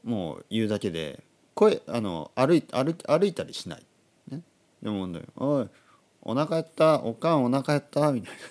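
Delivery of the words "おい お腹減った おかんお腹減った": louder, higher hungry whine to mom; mock demanding call to his mother; himself calling mom. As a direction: call out for food like a kid. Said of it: "今と変わんないですね".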